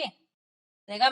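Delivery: plain reading voice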